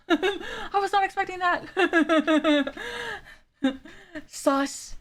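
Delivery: slow words through real laughter; laughing. Real, not acted. Streamer laughing at an unexpected game line. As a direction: laugh it out, caught off guard.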